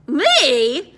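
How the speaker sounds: high, loud yelp of disbelief; alarmed surprise. A narrator's reading. Eddie learning he must get them inside, alarmed. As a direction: squeak it out, startled.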